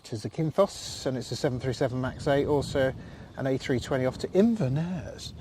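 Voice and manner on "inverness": lightly hammed-up Scottish place name; badly imitated Scottish accent; terrible mock Scottish accent. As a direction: give it a bad, playful Scottish lilt. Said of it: "that's a terrible accent".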